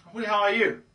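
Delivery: loud, high cocky challenge; really cocky. Shown as a demonstration. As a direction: challenge them with full swagger. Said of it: "being really cocky".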